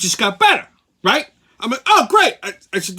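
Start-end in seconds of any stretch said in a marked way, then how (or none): 1.9-2.3 high, loud burst of delight; mock thrilled oh great. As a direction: squeal with joy at the donut. Delighted at seeing the donut.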